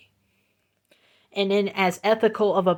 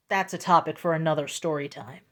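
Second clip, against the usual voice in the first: ordinary delivery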